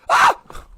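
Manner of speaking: short, loud gasping shriek; startled excited scream. Acted. Man sees the fire appear, short excited shriek. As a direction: let out a sudden loud yelp. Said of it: "he's gonna go like".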